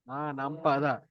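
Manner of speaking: ordinary talk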